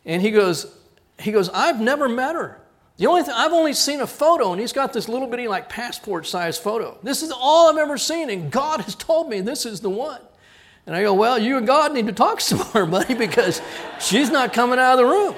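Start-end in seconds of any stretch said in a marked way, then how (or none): 1.5-10.2 a suitor's excited insistence; pleading suitor. Retold or imitated. Suitor pleading, voiced by pastor. 11.1-15.3 laughing retort. Retold or imitated. Pastor retells his joking reply.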